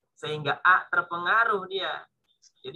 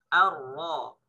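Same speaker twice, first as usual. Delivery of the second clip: normal talking voice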